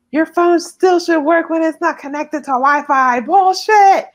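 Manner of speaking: retold yelling. Girlfriend yelling at boyfriend's brother over an excuse, acted out. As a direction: call out the excuse, ending in a yell. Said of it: "I'm yelling at his brother".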